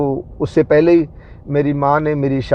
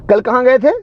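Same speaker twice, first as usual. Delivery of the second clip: high, louder accusing question with a rising end; angry question; 8 semitones higher, 4 dB louder than usual. Retold or imitated. Wife confronting him about yesterday, high accusing rise. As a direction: demand it like she's been waiting hours. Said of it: "इतना गुस्से से बोली".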